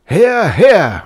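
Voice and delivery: high, loud rowdy cheer; roaring students. A narrator's reading. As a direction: roar approval as a student crowd. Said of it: "roared the students".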